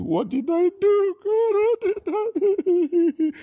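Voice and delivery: shrill high wail, half laughing, half crying; mock wailing cry. Pastor imitating a woman crying to God, high mock wail. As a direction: howl it out like a desperate crying woman, very high and loud.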